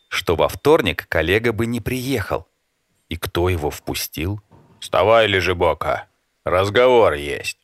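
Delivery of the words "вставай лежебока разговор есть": a lightly voiced unfamiliar intruder; stranger's voice. A narrator's reading. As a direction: wake him with a stranger's calm command. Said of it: "услышав незнакомый голос".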